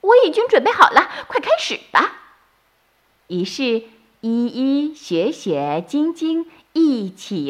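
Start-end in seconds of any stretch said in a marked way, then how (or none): 0.0-2.1 very high, fast and excited; can't wait to start the trap; eager bunny. A narrator's reading. (小兔急切兴奋)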